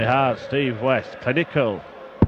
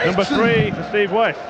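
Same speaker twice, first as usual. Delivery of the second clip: excited; 7 semitones higher than usual. Real, not acted. Commentator reacts to a 180.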